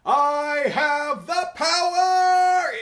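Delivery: very high, loud, drawn-out hero cry; He-Man. Retold or imitated. Mimicking an eighties action hero, way-up-there heroic yell. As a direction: belt a battle cry way up in your register. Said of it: "It's, like, way up there".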